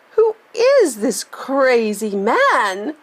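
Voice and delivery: put-on old woman shrieking at the intruder; mild scream. A narrator's reading. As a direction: demand to know who he is. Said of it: "screamed Grandma Josephine".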